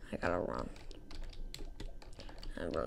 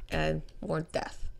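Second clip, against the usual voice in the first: ordinary talk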